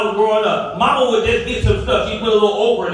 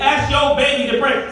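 usual speaking voice

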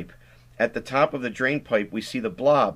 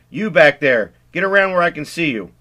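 louder, higher order down the pipe; police command; 6 semitones higher, 9 dB louder than usual. Acted. Deputy ordering the kids out, loud command. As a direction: call them out with authority.

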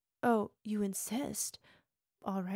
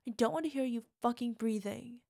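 ordinary delivery

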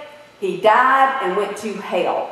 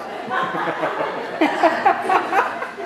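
airy unvoiced laugh at own joke; breathy laugh; 7 semitones higher, 4 dB quieter than usual. Real, not acted. Preacher laughs at his joke about throwing out kids' pictures, breathy laugh. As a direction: let a breathless laugh escape.

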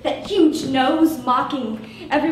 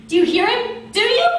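higher and louder, frantic hammed-up question; rising panic; 7 semitones higher, 5 dB louder than usual. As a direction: push the fear up to a shriek.

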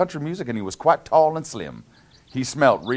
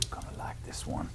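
whisper; 16 dB quieter than usual. Real, not acted. Whispered aside to kids.

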